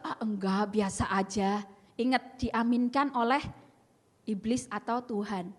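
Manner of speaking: usual speaking voice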